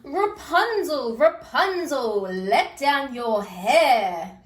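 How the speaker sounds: loud, slow call up the tower; prince calling. Acted. Prince calling up to Rapunzel, loud slow call. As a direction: call up to her from below. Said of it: "cried the prince".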